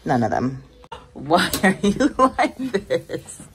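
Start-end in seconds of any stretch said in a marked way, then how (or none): 1.1-3.4 laughing. Real, not acted. Teasing friend, breaking into laughter.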